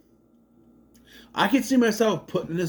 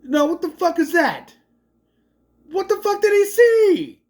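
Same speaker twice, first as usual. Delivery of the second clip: high, loud incredulous yelling; outraged yell; 13 semitones higher, 6 dB louder than usual. Retold or imitated. A viewer yelling at the movie's ending in outrage. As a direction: shout it in disbelief at the screen. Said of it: "And I go".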